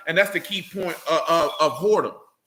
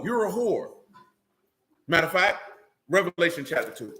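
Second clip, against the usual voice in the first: normal talking voice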